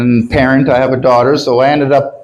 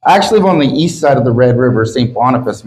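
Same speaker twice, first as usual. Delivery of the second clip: ordinary delivery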